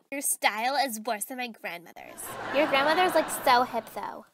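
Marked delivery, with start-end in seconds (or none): none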